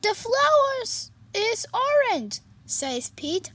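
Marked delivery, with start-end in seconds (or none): 0.0-2.4 higher, louder bright cat voice; cheerful kitty voice. Acted. Pete greeting his sad friend, high and cheerful.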